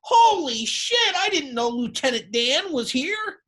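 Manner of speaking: very high, loud, drawn-out dad exclamation; high nasally voice. Retold or imitated. Dad Bob commenting on the man in a wheelchair, high and nasally. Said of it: "He talks in a real high, nasally voice".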